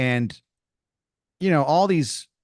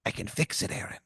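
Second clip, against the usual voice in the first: quiet, secretive pirate voice; pirate tomato; 9 dB quieter than usual. Acted. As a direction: whisper it like a conspiring pirate. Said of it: "He goes".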